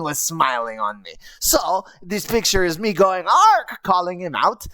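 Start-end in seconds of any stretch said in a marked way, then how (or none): 3.3-3.8 high loud call across the field. Retold or imitated. Goblin calling out to Ark, loud and high. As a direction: shout his name to get attention.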